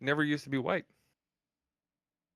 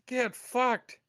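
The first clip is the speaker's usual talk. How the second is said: much higher, incredulous dismissal; scornful retort; 12 semitones higher, 4 dB louder than usual. Real, not acted. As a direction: throw it out as a high-pitched scoff. Scornful retort to being called nice.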